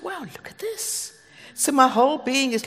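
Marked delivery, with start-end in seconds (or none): 0.0-1.1 high, soft retold wonder; hushed amazement. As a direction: marvel under your breath, pitched up. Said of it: "And I was amazed".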